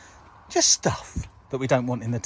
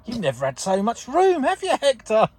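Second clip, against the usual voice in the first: talking to a pet; 10 semitones higher, 3 dB louder than usual. Real, not acted. Talking to the dog.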